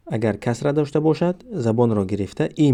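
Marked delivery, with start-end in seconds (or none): none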